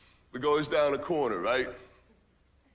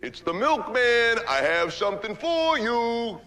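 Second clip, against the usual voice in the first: higher, slow, mock-suggestive milkman; porno milkman; 7 semitones higher, slower than usual. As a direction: purr it like a cheesy porn line. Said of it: "Guy outside goes".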